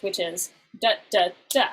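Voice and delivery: da da da, last beat stressed; anapest rhythm. Shown as a demonstration. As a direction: tap out the anapest, weak weak strong. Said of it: "and the anapest".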